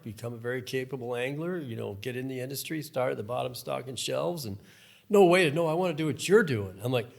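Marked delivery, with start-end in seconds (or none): 5.1-6.8 loud, higher mock protest; imitating an eager kid who won't listen; insistent interruption. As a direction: cut in like an impatient youngster. Retold youngster interrupts the advice, insisting.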